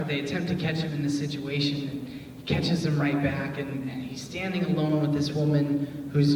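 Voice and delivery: usual speaking voice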